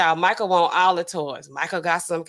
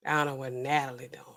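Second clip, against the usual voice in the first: muttered aside; 6 semitones lower, 10 dB quieter than usual. Retold or imitated. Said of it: "They're like".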